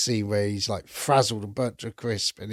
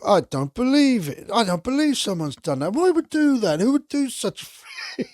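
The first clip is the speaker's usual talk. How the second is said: much higher, louder mock-innocent protest; innocently guilty; 12 semitones higher, 3 dB louder than usual. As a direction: overplay the innocence, squeaking up high. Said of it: "acting so innocently guilty".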